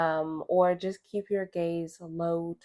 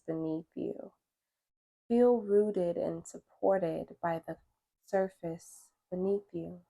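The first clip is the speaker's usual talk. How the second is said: guided meditation; 4 semitones higher than usual. Acted. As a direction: guide them gently through breath and stretch, unhurried.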